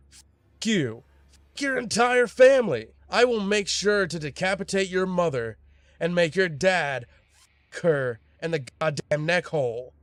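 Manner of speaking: high-pitched ranting threat; angry cussing out. A narrator's reading. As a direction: act out a furious gamer, pitched well up. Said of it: "this guy starts cussing me out".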